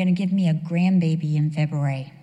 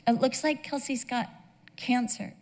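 choked-up retelling; 4 semitones higher, 6 dB quieter than usual. Retold or imitated. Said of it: "I was crying so hard".

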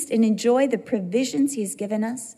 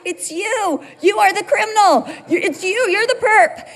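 helpless with laughter; 10 semitones higher, 3 dB louder than usual. Retold or imitated. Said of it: "I can't 'cause I'm laughing so hard".

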